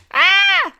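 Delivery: put-on a sudden high, loud scream like a small girl; girlish scream. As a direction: shriek like a terrified little girl. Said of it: "Ginger screams like a little".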